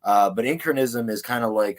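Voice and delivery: ordinary delivery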